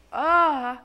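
high "ah" launched at once; full-voiced high "ah"; go-to outburst. Shown as a demonstration. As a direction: demonstrate a big, high "ah" straight off. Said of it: "your kind of go-to place".

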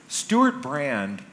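usual speaking voice

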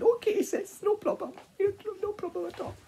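very high piggy voice; chirpy piglets agreeing; squeaky little pigs. Acted. Piggies answering their sister, high squeaky voice.

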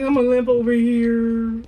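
a loud mock voice of the teammate; mocking quote. Mocking a teammate's limping. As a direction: ham up the other player's words.

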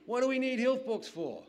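high, loud, laughing disbelief; laughing scoff. Retold or imitated. Old granny laughing off the health books. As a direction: laugh it off as absurd.

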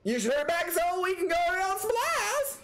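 very high put-on southern voice; exaggerated high southern twang; southern accent. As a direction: ask it in a squeaky southern accent. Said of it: "hear [name] do that voice".

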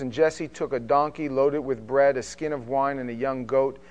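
measured, steady Bible reading; formal reading of scripture aloud. Acted. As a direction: read the passage with weight, slowing on key lines.

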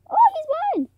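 high, loud cry of triumph; excited squeal. Real, not acted. Player claiming the donkey in a game, excited squeal.

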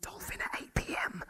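breathless secret whisper; sneaky hushed arrangement; whispered aside. Acted. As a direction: hiss it so the boss can't hear.

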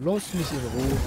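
Lass mich in Ruhe loud and fed up, pitch rising at the end. Real, not acted. Annoyed at game character, repeats.

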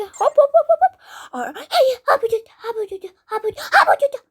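high, loud hop hop chant; frantic hopping cries. Real, not acted. High frantic yelps. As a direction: gamer chanting hop with each frantic jump.

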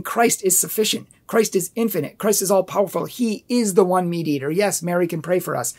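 ordinary talk, nothing marked

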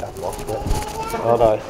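ordinary talk

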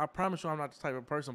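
usual speaking voice

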